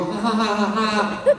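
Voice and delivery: put-on ha-ha laugh; imitated laughing. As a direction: act out amused judges laughing. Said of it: "They thought I'm kidding. They go".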